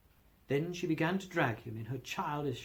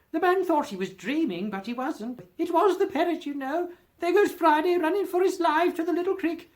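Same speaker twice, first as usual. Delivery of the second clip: very high, loud and eager; calling out childhood memories, carried away; excited cry; 13 semitones higher, 8 dB louder than usual. A narrator's reading. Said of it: "cried Scrooge".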